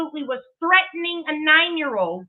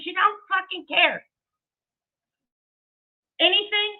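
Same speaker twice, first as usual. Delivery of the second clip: ordinary talk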